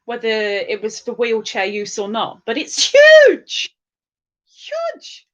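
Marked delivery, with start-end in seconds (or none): 2.5-3.8 loud high burst on huge; over-the-top high exclamation; hammed-up amazement. Exclaiming again over van size. 4.5-5.3 very high, soft squeak; small squeaky afterthought; tiny high echo. Pretend.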